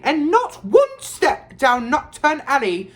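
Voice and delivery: high, loud warning yell; motherly shout. A narrator's reading. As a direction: shout a warning after departing children. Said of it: "she shouted at the twins' retreating backs".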